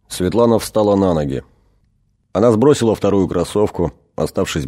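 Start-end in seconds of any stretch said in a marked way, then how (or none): none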